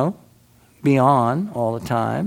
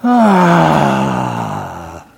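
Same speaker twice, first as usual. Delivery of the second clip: low, drawn-out groaning "Ah" in a different voice; imitated groan; 8 semitones lower, 7 dB louder than usual. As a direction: mimic a friend's loud pained groan. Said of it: "she kept going".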